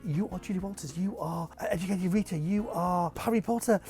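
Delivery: building to loud, high disbelief; awestruck. Retold or imitated. Vlogger reliving his awe at Julie Walters, building to loud amazement. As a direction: marvel harder with each credit. Acting awestruck thoughts at meeting star.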